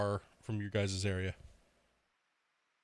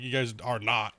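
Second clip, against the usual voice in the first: ordinary talk, nothing marked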